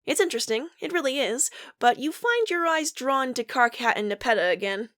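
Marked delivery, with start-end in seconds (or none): none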